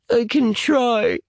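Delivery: put-on very high, slow child's voice; tearful little boy. Crying boy agrees to breathe deeply, high and hesitant. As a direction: squeeze it out small and high.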